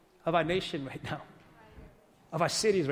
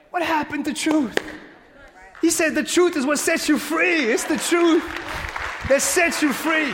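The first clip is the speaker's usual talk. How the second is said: loud, much higher, hammering the word truth; rising preaching climax; 7 semitones higher, 4 dB louder than usual. Acted. Preacher confronting the church about truth, loud climax. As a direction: build to a preaching peak.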